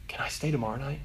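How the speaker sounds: put-on small, soft voice of a hopeful boy; hushed, tentative plea; timid request. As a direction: ask it very quietly, unsure of the answer. Boy timidly asks to stay.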